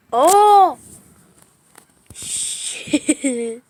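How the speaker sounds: sudden loud fright; startled shout. Real, not acted. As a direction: jolt and cry out. Startled by lightning.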